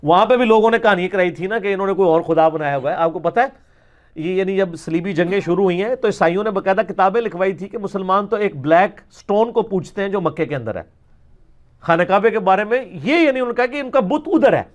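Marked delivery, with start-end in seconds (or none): none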